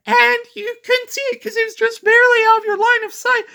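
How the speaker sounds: over-the-top high woman voice; mock woman voice. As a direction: squeak it out like a hammed-up movie seductress. Woman villain explains her plan.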